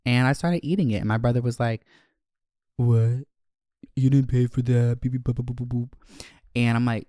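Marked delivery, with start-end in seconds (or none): 2.8-5.0 loud mock outrage of a brother; accusing little brother. Voicing tattling brother. 5.0-5.9 spoken beep-boop nonsense in a monotone; mock babble. Brother's nagging turned to babble.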